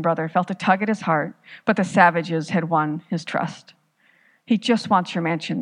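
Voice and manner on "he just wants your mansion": usual speaking voice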